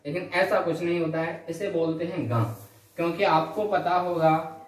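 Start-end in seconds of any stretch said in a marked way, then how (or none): 2.3-2.4 "गा" as a velar nasal, ṅã; nasalised ṅa. Shown as a demonstration. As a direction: let the air of "गा" out through mouth and nose. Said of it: "प्राणवायु मुख और नासिका दोनों से निकलती है".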